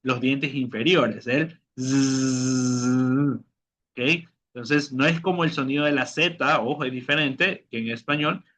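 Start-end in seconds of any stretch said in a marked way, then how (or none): 1.8-3.4 long steady buzzing Z; zumbido de abeja. Shown as a demonstration. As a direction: buzz like a bee. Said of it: "es un sonido de la abeja". (zumbido largo de abeja)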